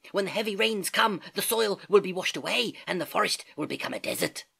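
quick, busy chatter from the treetops; chattering monkeys. Acted. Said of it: "They chattered to the sleeping man".

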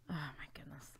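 breathless whispered oh my goodness; unvoiced groan of disbelief; whispered dismay. Real, not acted. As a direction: whisper it in shock after dying.

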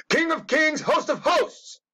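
mock preacher proclamation. Hamming a preacher's title call, high and ringing.

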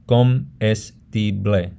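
comestible split slowly into co-mes-ti-ble; syllable by syllable. Shown as a demonstration. Teacher shows how a word breaks up, syllable by syllable. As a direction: say comestible broken into separate syllables. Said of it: "how you break up a word".

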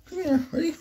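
very high, soft doggy talk; coaxing the dog. Real, not acted. Owner getting the dog ready for a picture, high and coaxing. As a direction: call the pet in a tiny high voice.

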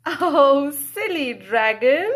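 delighted reading-aloud exclamation; amused exclaim. Acted. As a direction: tease the dragon playfully. Reacting to picture, amused.

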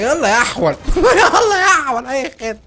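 loud, slow mocking laugh-taunt. Real, not acted. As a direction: tease the enemy through laughter. (سخرية وضحك بصوت عالي)

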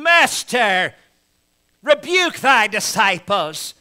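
a hint of prim Pharisee grievance; mildly whiny protest; Pharisees' complaint. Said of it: "said to him".

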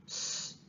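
a soft voiceless ssss with no voice; S breathed out as a hiss; hissed s sound. Shown as a demonstration. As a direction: let the S hiss out quietly. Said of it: "je prononce le son".

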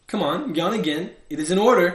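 loud, high kingly order; insistent order. A narrator's reading. King demanding another yawn, insistent. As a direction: build to an imperious loud command.

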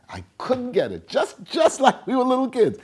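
ordinary talk, nothing marked

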